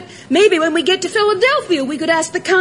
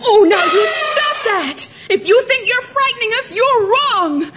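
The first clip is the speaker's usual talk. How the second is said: yelling telling-off; 4 semitones higher than usual. Acted. Girl confronting a frightening elephant, yelling. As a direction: yell it to stand your ground. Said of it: "don't yell at me".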